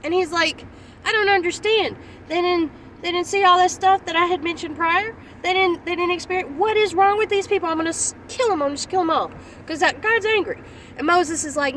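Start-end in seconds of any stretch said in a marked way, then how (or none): 1.1-10.6 higher, exasperated rant as an angry God; mock angry God. Preacher voicing God fed up with his people. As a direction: voice God's frustration, raised and incredulous. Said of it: "And God gets angry".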